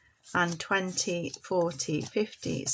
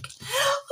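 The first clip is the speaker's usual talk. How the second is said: high puppet gasp. Pretend. Puppet Polly gasping.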